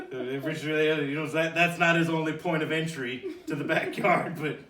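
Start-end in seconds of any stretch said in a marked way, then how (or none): none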